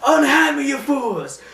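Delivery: high, loud villain shout; hammed-up villain yell; Scarecrow rant. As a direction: bellow like a ranting cartoon villain. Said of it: "Scarecrow's like this".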